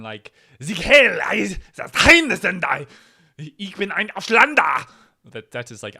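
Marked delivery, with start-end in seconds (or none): 0.6-4.9 shouted fake German, high and loud; mock German shout. Kid home from school, mock German shouting. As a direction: bark it like a parody German rally. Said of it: "kids are coming back home from school and going".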